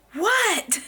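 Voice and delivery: high startled "What?" that falls. Retold or imitated. Retelling hitting a cow with the car, startled "What?". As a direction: blurt it like a cow is in your windshield. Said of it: "and I was like".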